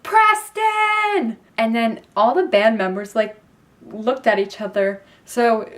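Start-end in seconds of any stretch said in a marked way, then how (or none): 0.0-1.4 high, loud fan scream. Retold or imitated. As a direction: scream the town name at the stage. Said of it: "And I screamed".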